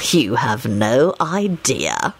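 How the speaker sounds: low, very slow sly reply; slyly stretched words. A narrator's reading. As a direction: draw it out low and knowing. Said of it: "Lupin responded slyly".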